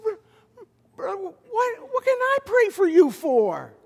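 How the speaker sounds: surprised pastor asking the weeping superintendent; startled question. Retold or imitated. Retelling his own startled reply.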